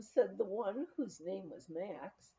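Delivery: usual speaking voice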